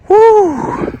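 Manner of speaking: a high whoop of triumph at the top; excited whoop. Real, not acted. Hiker reaching the hilltop whoops at the view, high and excited.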